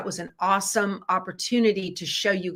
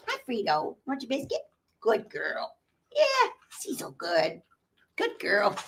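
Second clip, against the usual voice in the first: soft pet talk; 4 semitones higher, 5 dB quieter than usual. Real, not acted. Talking softly to her dog.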